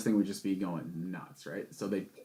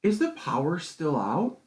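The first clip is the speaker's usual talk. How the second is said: retold woman's question; 5 semitones higher, slower than usual. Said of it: "she asked me".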